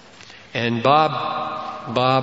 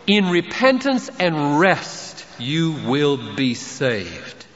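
slow scripture reading; much slower than usual. Acted.

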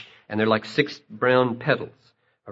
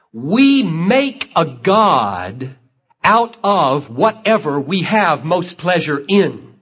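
higher, louder, emphatic sermon delivery; full-force preaching; 6 semitones higher, 8 dB louder than usual. Acted. Preacher rebuts the question before the congregation, full force. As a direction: drive the point home from the pulpit.

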